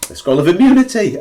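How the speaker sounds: loud, high excited exclamation. Real, not acted. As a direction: burst out with delight. Lucky find in the game.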